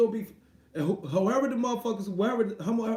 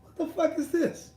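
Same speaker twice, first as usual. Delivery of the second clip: exasperated disbelief; 9 semitones higher than usual. Retold or imitated. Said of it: "I'm like".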